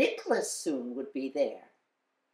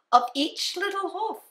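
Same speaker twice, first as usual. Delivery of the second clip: usual speaking voice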